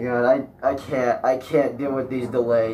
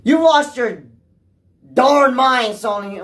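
higher and louder, fed up; frustrated yell; 9 semitones higher, 6 dB louder, slower than usual. Real, not acted. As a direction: yell it at Sony, then drop off on the name. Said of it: "trying to chill with my cuss words".

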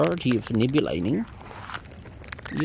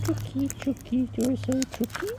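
calling chickens; 11 semitones higher than usual. Real, not acted. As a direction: coo at the bird up high. Sing-song high to bird.

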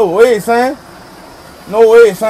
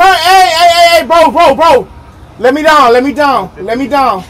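loud, frightened pleas, fading; desperate shouting to the operator; panicked begging; 3 semitones higher, 10 dB louder than usual. Real, not acted. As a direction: beg to be let down. Begging to be let down.

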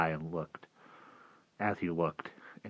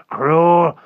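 sudden loud, high, anguished cry; broken wail; 11 semitones higher, 16 dB louder than usual. A narrator's reading. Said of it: "his voice was broken and simple".

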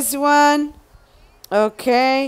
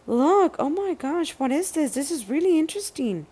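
much softer, fast, awed; hushed wonder; 3 semitones higher, 9 dB quieter, much faster than usual. Real, not acted. Finding the stickers, hushed and amazed. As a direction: breathe it out in wonder.

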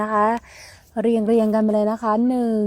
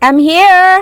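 loud and higher call; 5 semitones higher, 11 dB louder than usual. Real, not acted. Calling out to her sister in the forest, loud. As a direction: shout it across the woods.

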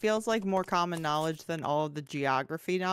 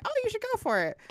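high encouraging voice; faster than usual. Retold or imitated. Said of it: "Celine was like".

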